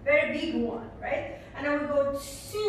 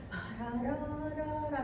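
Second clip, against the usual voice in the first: very quiet; 7 dB quieter than usual. Shown as a demonstration. Dance teacher showing students the singer's very quiet voice. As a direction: murmur the rhythm like the singer going quiet. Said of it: "very quiet voice".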